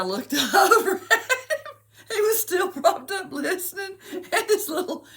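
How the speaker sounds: high, loud squeal of laughter through the words; barely getting it out for laughing; helpless laughter. Real, not acted. Recalling her son listening to the poem, cracking up. Overcome by laughter mid-story.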